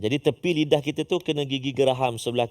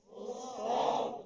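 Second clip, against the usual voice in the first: whisper practice; 7 semitones higher, 14 dB quieter than usual. Pretend.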